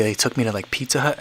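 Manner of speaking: ordinary talk, nothing marked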